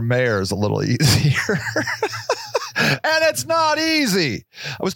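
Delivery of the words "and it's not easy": comic shout. Real, not acted. Shouted aside.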